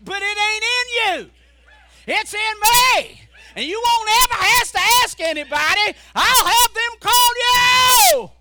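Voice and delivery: loud high shout of God; God proclaiming. Acted. God answering doubtful preacher, loud shout. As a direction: shout God's promise.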